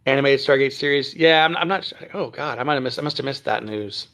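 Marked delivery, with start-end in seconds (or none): none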